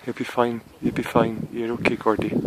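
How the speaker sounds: soft, gentle reassurance; softly soothing a frightened dog; cooing to a scared pet. Real, not acted. Soothing dog being hauled up.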